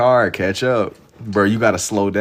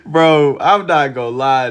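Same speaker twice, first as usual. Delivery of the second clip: laughed out, higher and louder; laughing; 6 semitones higher, 5 dB louder, slower than usual. Real, not acted. Streamer to chat, laughing at the reply. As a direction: laugh through the words. Laughs after chat's answer.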